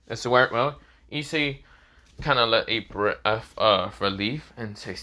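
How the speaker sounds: ordinary delivery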